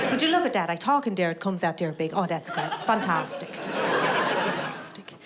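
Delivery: mock Irish accent, marvelling; delighted Irish announcer. Announcer enjoying his own amplified words, delighted Irish accent. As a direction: play an Irishman tickled by the loudspeaker. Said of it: "he's just enjoying making the words go big".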